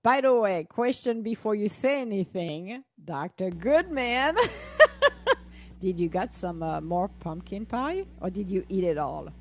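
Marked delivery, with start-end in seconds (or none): 4.4-5.8 high, loud burst of laughter; teasing laugh. Real, not acted. Host teasing Doctor Goodman about pie, loud laughter. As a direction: laugh out loud at your own tease.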